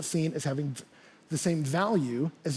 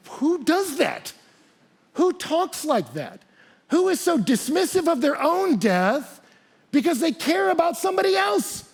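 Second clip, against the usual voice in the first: high, slow acted incredulity, building louder; astonished crowd; 10 semitones higher, 3 dB louder than usual. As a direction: marvel in drawn-out rising questions. Amazed crowd questions the martyr.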